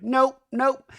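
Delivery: high, loud "nope, nope"; dismissive refusal. Retold or imitated. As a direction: shut it down in a high voice. Acts out Brittany's refusal.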